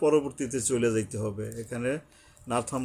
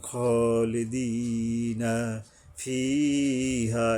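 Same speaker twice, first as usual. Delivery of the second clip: very slow chant, long ee in ফিহা; elongated recitation; much slower than usual. Shown as a demonstration. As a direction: hold each long vowel of খলিদিনা ফিহা evenly. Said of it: "ইয়ার উপরে এক আলিফ". (খলিদিনা ফিহা ধীরে টেনে)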